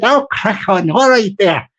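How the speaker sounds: ordinary talk, nothing marked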